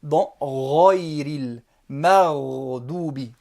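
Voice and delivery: Ghayril Maghdubi said slowly and low, with a deep throaty R; emphatic Arabic recitation. Shown as a demonstration. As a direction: stretch Ghayril Maghdubi out in a heavy voice. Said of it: "le roin, comme un R français, mais emphatique, très grave".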